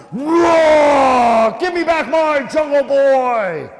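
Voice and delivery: huge, drawn-out bellow; angry bear roar. Acted. Baloo the bear to the fleeing monkeys, furious roar. Baloo wakes and roars at kidnappers.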